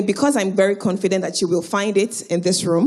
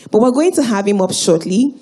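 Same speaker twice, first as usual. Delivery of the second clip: usual speaking voice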